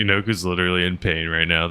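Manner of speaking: usual speaking voice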